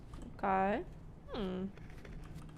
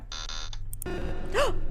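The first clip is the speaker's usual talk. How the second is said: startled gasp; 6 semitones higher, 4 dB quieter than usual. Real, not acted. Jump scare.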